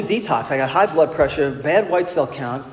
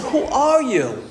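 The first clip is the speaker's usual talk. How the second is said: high, slow, drawn-out question; suspicious surprise; 8 semitones higher, much slower than usual. Retold or imitated. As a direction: stretch it out in mock disbelief. Suspicious question to the same man.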